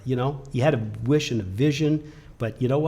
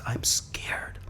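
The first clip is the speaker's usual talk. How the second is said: scared whisper; 7 dB quieter than usual. Retold or imitated. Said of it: "this kid is terrified".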